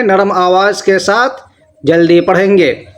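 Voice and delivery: ordinary delivery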